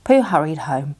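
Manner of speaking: usual speaking voice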